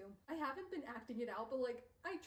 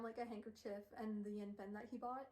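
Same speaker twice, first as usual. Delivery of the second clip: normal talking voice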